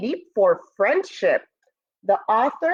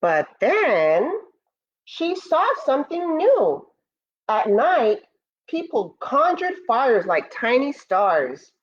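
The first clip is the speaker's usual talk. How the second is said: storybook narration; 3 semitones higher than usual. Acted. Teacher reading Moon's First Friends to students, storybook voice. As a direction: read it like a bedtime story for the class. Reads picture book aloud.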